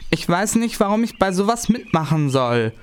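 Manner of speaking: high-pitched reluctant protest; protesting boy. Acted. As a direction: push back, voice up high.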